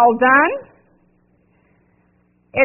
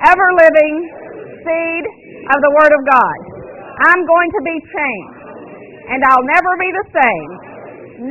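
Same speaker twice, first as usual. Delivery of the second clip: declaration; 3 semitones higher than usual. Acted. Pastor leading the church in a bold spoken confession. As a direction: proclaim each line with conviction.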